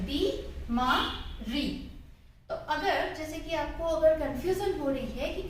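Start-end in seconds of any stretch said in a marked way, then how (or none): 0.0-1.9 बी मा री broken into slow syllables. Shown as a demonstration. Teacher breaks the word into syllables for children. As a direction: spell out बी, मा, री slowly with pauses. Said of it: "दोनों पर बड़ी ई की मात्रा".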